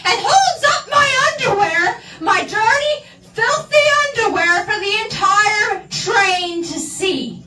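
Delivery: mortified outburst. Real, not acted. Reliving the embarrassment.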